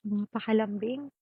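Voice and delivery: ordinary delivery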